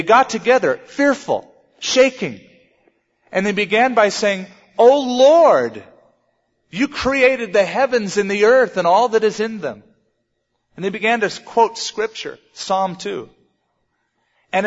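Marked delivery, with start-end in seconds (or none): 4.8-9.8 loud, higher cry to God on "O Lord"; fervent prayer. Acted. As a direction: call out in earnest prayer. Acting the disciples' fearful prayer aloud.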